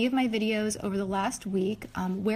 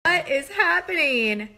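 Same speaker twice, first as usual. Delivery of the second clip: shocked; 6 semitones higher, 4 dB louder than usual. Real, not acted. Shocked by the commercial.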